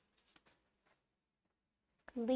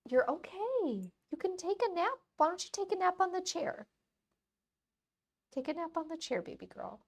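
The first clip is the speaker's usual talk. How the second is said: pet-talk; 8 semitones higher than usual. Real, not acted. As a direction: coax the puppy into a nap. Coaxing dog to nap.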